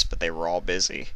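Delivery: ordinary delivery, nothing marked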